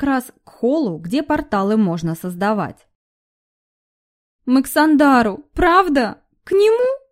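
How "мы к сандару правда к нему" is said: rising, scared whining plea; frightened whimper. A narrator's reading. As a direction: whimper it out, pitch climbing in fear. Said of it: "захныкала испуганная тень". (испуганно хныча)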